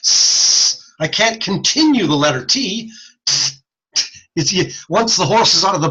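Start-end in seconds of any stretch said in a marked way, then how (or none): 0.0-0.9 S sustained as a continuous hiss; held S. Shown as a demonstration. Showing the class that S can be held. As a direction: keep the S hissing without a break. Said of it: "I can continue the letter S".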